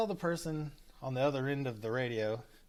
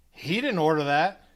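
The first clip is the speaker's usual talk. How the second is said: higher, much louder, cutting protest; rude accusation; 4 semitones higher, 8 dB louder than usual. Retold or imitated. Drive-thru girl to customer, rudely loud. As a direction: butt in rudely, voice raised over the customer. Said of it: "Like, rude as fuck".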